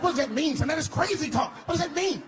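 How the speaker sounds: very high, loud alarmed questions; father mortified in front of parents, high and loud; panicked disbelief. Retold or imitated. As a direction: squeak it out in panic.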